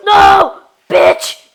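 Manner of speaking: loud shocked yell; shocked shout. Real, not acted. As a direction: scream in dismay. Shout at death.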